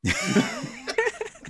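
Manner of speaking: a high, breathy burst of real laughter. Real, not acted. Game master laughs at the players' plan, high breathy laugh.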